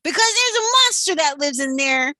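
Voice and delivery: loud, high urgent warning; urgent loud exclamation; exclaiming. A narrator's reading. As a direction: exclaim the danger. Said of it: "exclaimed Maynard".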